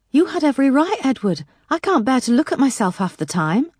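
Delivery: high, loud, tearful Yorkshire-free pleading. A narrator's reading. A woman near tears to her late partner's father, high and loud. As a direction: near sobs, self-blame spilling out. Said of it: "she was sobbing, hiccuping".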